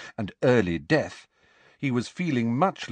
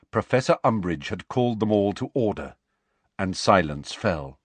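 ordinary delivery

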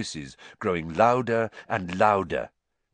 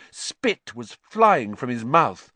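ordinary delivery, nothing marked

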